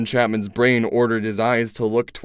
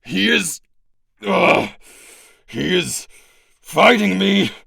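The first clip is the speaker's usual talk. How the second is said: strained struggling voice; 7 semitones higher, slower than usual. Acted. Struggling against rebelling host.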